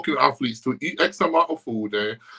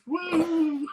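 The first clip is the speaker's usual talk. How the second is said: whooping cheer; 5 dB quieter than usual. Real, not acted. Cheering own joke.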